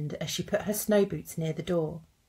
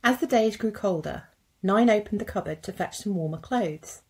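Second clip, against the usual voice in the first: ordinary delivery, nothing marked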